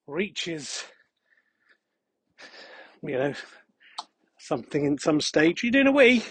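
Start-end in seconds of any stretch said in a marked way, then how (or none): 5.6-6.2 higher, louder remark to the dog; talking to the dog. Real, not acted. Man teasing his dog mid-walk, higher and louder. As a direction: tease the dog as she pees.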